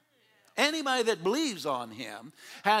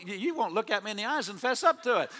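ordinary talk

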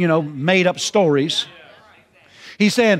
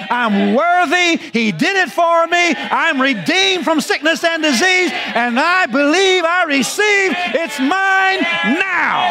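triumphant declaration; 9 semitones higher, slower than usual. Pretend. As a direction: shout the confession like a victory. Preacher shouts faith confession.

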